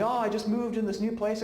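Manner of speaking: a hint of the friend, breezy; friend's voice. Friend telling the preacher her news, light and high. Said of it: "she told me".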